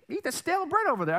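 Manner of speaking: high, quick mock-scolding mother; dismissive mom. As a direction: brush the kid off like a fed-up mom. Mother dismissing hungry child.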